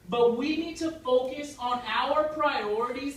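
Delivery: loud, slow, high mock-rousing declaration; mock rallying cry. Comic defending his wish to objectors, proclaiming loudly. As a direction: proclaim it slowly like a rousing speech.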